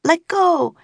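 very high, desperate cry; scream. A narrator's reading. Annie screaming at Baylor to let go, high. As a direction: scream it at the boy. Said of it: "screamed Annie".

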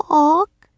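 high, questioning squawk; little bird croak, pitched high and rising; baby raven croak. A narrator's reading. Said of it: "he croaked again".

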